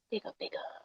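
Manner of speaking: whispered big up; breathy unvoiced whisper. Real, not acted. Whispered shoutout.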